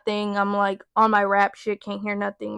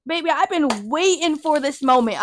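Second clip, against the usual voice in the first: gleeful taunt; 5 semitones higher than usual. Retold or imitated. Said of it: "And so I was like".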